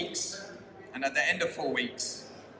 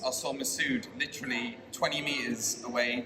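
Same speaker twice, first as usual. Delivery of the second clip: ordinary talk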